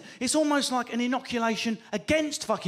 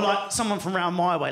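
usual speaking voice

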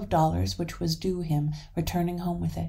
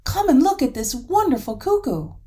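loud, high, excited call; calling across the garden in excitement; 8 semitones higher, 8 dB louder than usual. A narrator's reading. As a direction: beckon loudly to come and see. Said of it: "called Hans".

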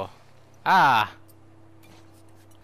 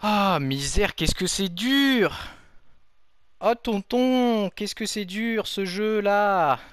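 high, slow wailing lament; drawn-out lament; 6 semitones higher, much slower than usual. Real, not acted. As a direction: wail it slowly, theatrically. Lamenting hard game. (lamentation traînée et aiguë)

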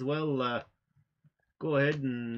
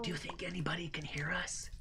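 secretive, hushed whisper; 9 dB quieter than usual. Pretend. Player asking partner if anyone hears, secretive whisper. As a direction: whisper it as if someone might overhear. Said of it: "Why are we whispering again?".

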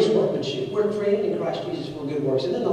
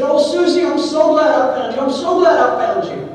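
relieved mother; 7 semitones higher, 8 dB louder than usual. Retold or imitated. Mother finds lost daughter, crying.

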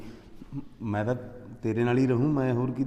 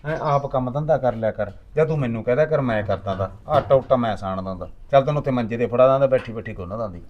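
much louder, scolding his mother; 3 semitones higher, 9 dB louder than usual. Acted. Son loudly scolds mother for fighting with wife. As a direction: tell her off loudly.

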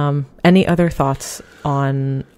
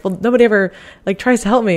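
higher and louder, aggrieved; whiny complaint; 7 semitones higher, 4 dB louder than usual. Retold or imitated. Person who never asks for help, complaining and aggrieved. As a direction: moan that nobody ever helps.

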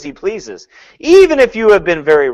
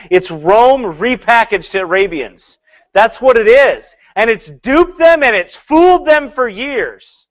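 slow, loud, rising preacher voice; building sermon emphasis; fiery preaching; 6 semitones higher, 4 dB louder, slower than usual. Real, not acted. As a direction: hammer each phrase home from the pulpit. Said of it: "preaching emphatically".

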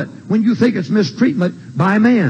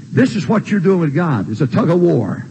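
ordinary talk

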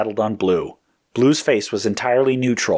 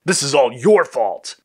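raised, gloating accusation; triumphant; 6 semitones higher, 4 dB louder than usual. A narrator's reading. Red blaming Green across the table, triumphant and higher. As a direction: crow the blame triumphantly. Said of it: "Red said triumphantly".

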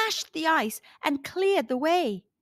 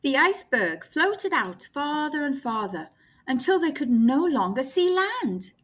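plain reading voice

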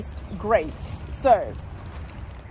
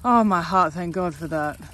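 relieved; 4 semitones lower, slower than usual. Real, not acted. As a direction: let the relief melt out. Relief as duck eats.

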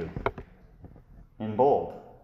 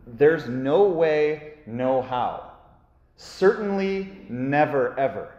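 no way spelled out slowly and firmly; emphatic; 3 semitones higher, 3 dB louder, much slower than usual. Shown as a demonstration. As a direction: drive home the absolute no. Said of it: "it's emphatic".

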